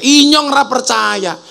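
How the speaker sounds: high, slow mock shout in ngapak; mock yell. Ngapak man shouts at the Sundanese, mock yell. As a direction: yell in disbelief, half joking. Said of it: "Orang ngapak langsung teriak".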